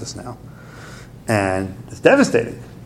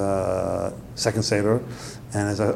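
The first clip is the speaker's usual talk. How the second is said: normal talking voice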